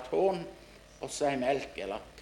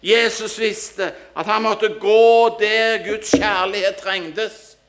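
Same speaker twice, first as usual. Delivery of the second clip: high, loud, slow preacher's appeal; impassioned preaching; 8 semitones higher, 11 dB louder, slower than usual. Acted. Preacher urging friends to open their hearts, impassioned preaching. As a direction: drive each phrase home to the congregation.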